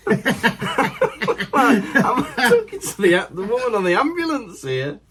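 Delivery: high, laughing retort. Retold or imitated. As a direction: get the words out through laughter. Laughing, retelling his own reply.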